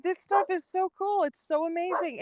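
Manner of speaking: high, gushing mock enthusiasm; imitating her own wide-eyed delight; gushing excitement. Telling friends how cool her hobby is, gushing. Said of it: "I'm like".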